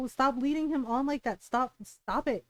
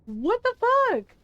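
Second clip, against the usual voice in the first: outraged disbelief; 8 semitones higher, 5 dB louder, slower than usual. Real, not acted. Outraged at Bianca.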